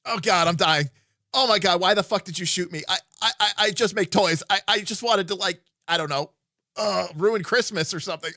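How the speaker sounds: high, loud panicked whining; terrified gibbering. Retold or imitated. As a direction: babble in pained panic. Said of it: "I literally did this".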